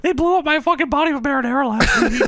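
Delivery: high mock squeal, on the edge of laughter; Paul Bearer. Wrestling manager grumbles about marinara, high comic squeal. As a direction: complain in a comic falsetto. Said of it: "Paul Bearer says".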